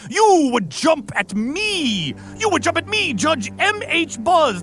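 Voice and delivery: slow, high, indignant judge; pompous judge. Acted. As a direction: drag out the outrage grandly. Judge scolding an attacker.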